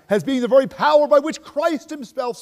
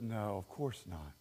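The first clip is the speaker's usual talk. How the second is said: lightly voiced father, slow and soft; easy reassuring dad, slow; a hint of a calm dad; 12 semitones lower, 18 dB quieter, slower than usual. Father answering his son, lightly voiced and soft.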